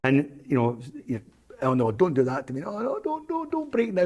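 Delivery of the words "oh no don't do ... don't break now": high-pitched panicky plea, rising to a squeak; a mock-desperate high plea to a stone; mock pleading. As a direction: beg the stone not to break.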